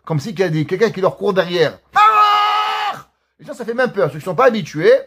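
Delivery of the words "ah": sudden high shouted cry; imitated prayer scream. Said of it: "Faut savoir, ils hurlent".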